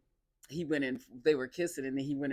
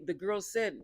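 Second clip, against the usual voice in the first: usual speaking voice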